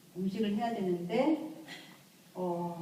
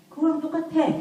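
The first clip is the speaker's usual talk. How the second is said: high firm reply; 9 semitones higher, 7 dB louder than usual. Shown as a demonstration. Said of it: "이렇게 얘기하셔야지".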